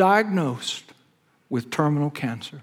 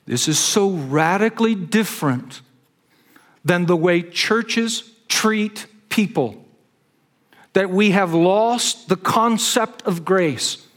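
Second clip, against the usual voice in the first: building sermon; 5 semitones higher than usual. Acted. Pastor to congregation about lost grace, rising sermon. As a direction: drive the point home from the pulpit.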